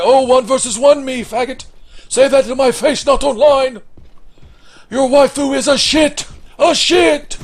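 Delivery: high, loud mock gamer rage; mad. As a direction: yell trash talk as a furious opponent. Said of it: "oh, he's gonna be mad".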